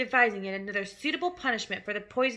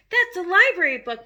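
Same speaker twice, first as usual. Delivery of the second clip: Matilda cries out louder, higher; crying out; 7 semitones higher, 9 dB louder than usual. A narrator's reading. Matilda protests to her father as he tears the book, loud and high. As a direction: protest loudly, a child pleading. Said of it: "Matilda cried".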